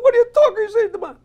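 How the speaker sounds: put-on high, loud, stunned Italian accent; astonished Italian farmer. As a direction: shout the question in a hammy Italian accent. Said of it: "He goes".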